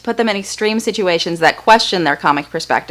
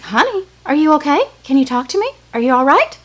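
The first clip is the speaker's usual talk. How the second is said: higher, sweet nurse voice asking gently; the nurse checking on little Matt, higher and gentle; worried nurse; 8 semitones higher than usual. Retold or imitated. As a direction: coax a stunned toddler to answer. Nurse voiced again, more worried.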